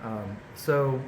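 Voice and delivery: normal talking voice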